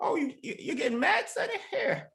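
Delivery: high teasing taunt; mocking taunt. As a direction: sneer at him playfully. Taunting enemy in character.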